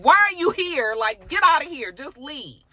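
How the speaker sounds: imitating an annoyed man; irritated dismissal. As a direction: shoo away an unwanted visitor. Acted sending away unwanted visitor.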